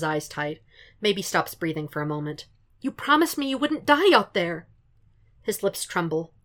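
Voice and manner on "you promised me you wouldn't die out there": high, loud tearful outburst; anguished cry. Acted. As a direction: cry it out in hurt. Foggy's anguished accusation.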